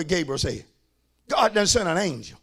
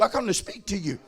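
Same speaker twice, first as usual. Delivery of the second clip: ordinary talk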